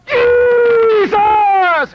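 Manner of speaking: high, loud, long-stretched cry; imitated yell. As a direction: yell the name out across a cafeteria. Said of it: "brother yelled".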